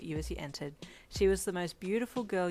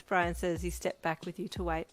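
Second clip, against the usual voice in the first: ordinary delivery, nothing marked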